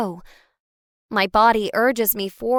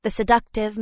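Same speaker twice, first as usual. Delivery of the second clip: ordinary delivery, nothing marked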